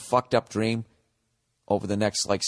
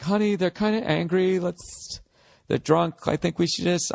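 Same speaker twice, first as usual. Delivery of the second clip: higher, pleading wifely voice; worried wife; 6 semitones higher than usual. Retold or imitated. Wife urging her husband to leave angry drunks, anxious. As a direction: nervously coax him away from trouble. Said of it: "your wife's going like".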